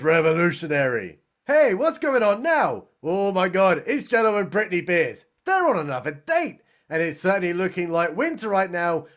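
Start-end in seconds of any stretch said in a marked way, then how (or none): none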